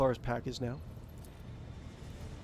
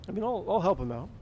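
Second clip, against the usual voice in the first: ordinary talk, nothing marked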